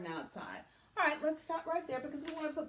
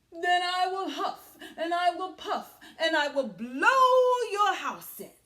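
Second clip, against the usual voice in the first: loud, higher hammed-up wolf threat; wolf's threat; 8 semitones higher, 9 dB louder than usual. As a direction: build the threat to a big bellow. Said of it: "wolf's threat".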